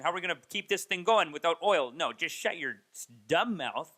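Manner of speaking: loud, scornful rant in a mocking voice; hammed-up lecture shouting down a fool; mocking Peterson. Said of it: "He's saying".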